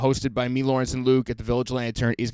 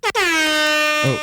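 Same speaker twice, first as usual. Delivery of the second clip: startled yelp. Real, not acted. Air horn startles him.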